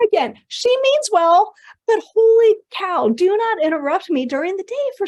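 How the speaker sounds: half laughing, throwing up her hands; loud, high, slowed disbelief; exasperated. Real, not acted. Laughing exasperation about mom.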